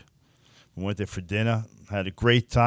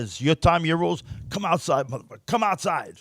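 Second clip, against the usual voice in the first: raised, retold angry dare; higher mock yell from the doorway; fuming challenge; 6 semitones higher than usual. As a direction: call him out, still fuming. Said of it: "Now I'm fuming".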